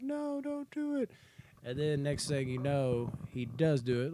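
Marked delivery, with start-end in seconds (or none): 0.0-1.1 much higher, slow mock plea; pleading girl. Jessica Biel's character begging Morgan not to shoot, high and slow. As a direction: beg him to stop in a high girl voice. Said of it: "Jessica Biel's character is just like".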